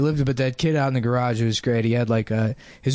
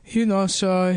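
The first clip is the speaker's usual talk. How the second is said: higher, put-on Mexican accent; Mexican dude; 7 semitones higher, slower than usual. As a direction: imitate a hesitant Mexican landlord. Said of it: "this Mexican dude's like".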